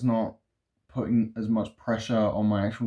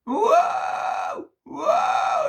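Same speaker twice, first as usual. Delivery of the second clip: scream; 4 dB louder than usual. Shown as a demonstration. Vocalist testing screams.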